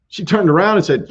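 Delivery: normal talking voice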